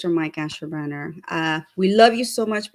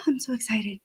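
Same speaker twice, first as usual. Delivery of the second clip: breathy excited whisper; 2 semitones higher, 5 dB quieter than usual. Real, not acted.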